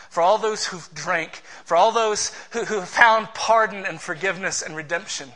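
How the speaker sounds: usual speaking voice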